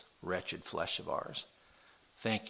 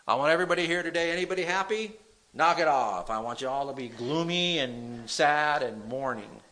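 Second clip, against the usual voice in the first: rising to a thundering, much higher and louder preacher's cry; preaching at full force; 11 semitones higher, 16 dB louder than usual. Acted. Pastor rebuking the nation before his congregation, thundering. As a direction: build from a push to a full roar of rebuke. Preaching against sin, building.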